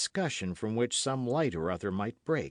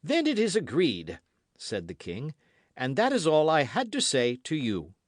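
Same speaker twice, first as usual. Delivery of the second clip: higher, louder dismissal; cold cruel king; 6 semitones higher, 5 dB louder than usual. A narrator's reading. King dismissing the pale queen, coldly cruel. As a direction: close the matter with cold satisfaction. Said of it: "enjoyed it with that cold cruelty".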